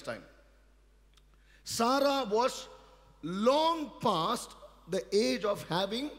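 loud, high preacher's cry, slowing on key words; fiery preaching. Acted. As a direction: preach it to the back row. Preaches at full force.

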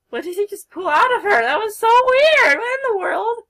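loud baffled exclamation; shocked outburst. Real, not acted. Shocked at weird game event.

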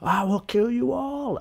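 high, slow hammed-up killer voice; mock villain threat. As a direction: threaten everyone like a cartoon villain. Voicing the ape killer.

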